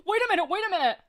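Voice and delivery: high, loud urgent cry; cried out. Acted. Narrator bursts into the story crying out high and loud. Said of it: "cried Jack the narrator".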